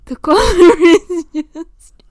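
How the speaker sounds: high, loud burst of laughter through the words; laughing. Real, not acted. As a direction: crack up mid-sentence. Interrupted by dog, bursts out laughing.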